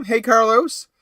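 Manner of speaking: ordinary talk, nothing marked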